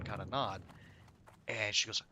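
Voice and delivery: ordinary talk, nothing marked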